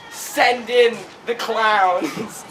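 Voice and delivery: very slow, stretched-out mock command; drawn-out announcement. Mister Electric summons the clowns, slow drawn-out command. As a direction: imitate a villain stretching every word. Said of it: "And he's like".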